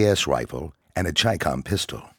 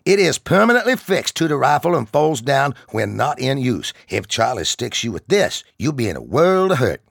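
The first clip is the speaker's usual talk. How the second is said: higher, louder barking sergeant voice; drill sergeant; 9 semitones higher, 6 dB louder than usual. Acted. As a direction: bark it at the recruits like a drill instructor. Sergeant lectures recruits.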